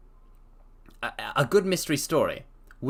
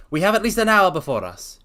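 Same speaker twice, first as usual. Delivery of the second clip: higher and louder as Holmes; 8 semitones higher, 7 dB louder than usual. Acted. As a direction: speak up as the detective. Holmes voice resumes.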